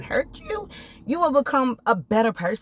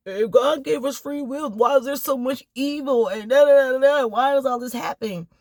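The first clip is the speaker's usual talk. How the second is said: sceptics' whiny complaint, pitched up and louder; mock the people who doubt God, up and loud; mocking complainers; 4 semitones higher, 4 dB louder than usual. Vlogger mocking people who ask why evil exists, whiny and louder. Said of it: "a lot of people always be like".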